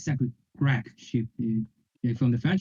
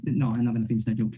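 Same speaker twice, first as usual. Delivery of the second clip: normal talking voice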